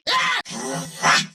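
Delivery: sharp, very high yelp; startled cry. Acted.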